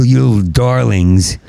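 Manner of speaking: ordinary delivery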